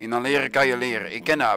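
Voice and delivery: normal talking voice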